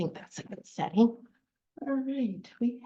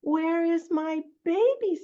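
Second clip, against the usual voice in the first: high-pitched mother cat; cat character; 8 semitones higher than usual. Acted. Storyteller voicing a cat seeking her kitten, high voice. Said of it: "says the cat".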